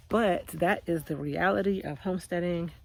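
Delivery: ordinary delivery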